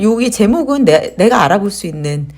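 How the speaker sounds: normal talking voice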